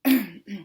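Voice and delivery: short throat-clear; deliberate little "ahem". A narrator's reading. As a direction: clear your throat before objecting. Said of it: "Emma Jean cleared her throat".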